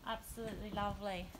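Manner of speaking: usual speaking voice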